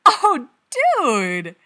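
very loud, high, thrilled exclamation; excited shout, stretched on dude; delighted, too loud. A narrator's reading. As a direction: burst out with delight at full volume. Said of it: "Pete's delighted ... a little too loud".

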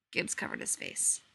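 plain reading voice

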